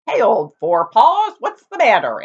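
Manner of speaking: put-on a cheerful old donkey calling out; friendly donkey. Donkey voice in the story.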